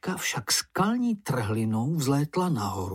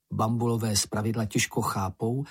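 plain reading voice

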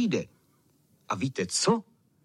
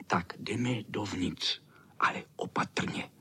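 cautious whisper; 4 semitones lower, 5 dB quieter than usual. A narrator's reading. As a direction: whisper it softly, stretched out with care. Boys cautiously sneaking into garden. (opatrný, pomalý šepot)

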